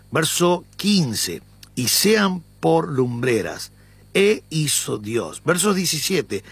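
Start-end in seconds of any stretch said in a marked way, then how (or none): 1.8-5.4 "Y" stressed and slowed. Shown as a demonstration. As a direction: lean on the "Y", read slowly. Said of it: "Yo voy a darle énfasis en la "y". (la "Y" enfatizada y lenta)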